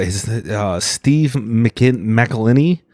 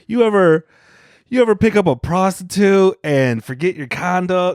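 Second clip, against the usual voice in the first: higher-pitched mock bar-comedy delivery; mock hack comic; 8 semitones higher than usual. Comic mocking hometown open-micers' old jokes, mock hack comic. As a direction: lightly imitate a hack stand-up pitching a joke.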